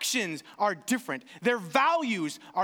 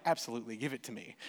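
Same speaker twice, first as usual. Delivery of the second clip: low, hushed, eager grab; secretly wanting it, say it under your breath; greedy inner voice; 11 semitones lower, 9 dB quieter, much faster than usual. Pretend.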